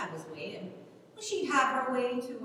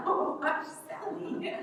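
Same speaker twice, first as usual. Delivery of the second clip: laughing, breathy hush; giggling hush; 11 semitones higher, slower than usual. Acted. Ellen hushes Sally's teasing, giggling. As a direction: shush her through embarrassed giggles.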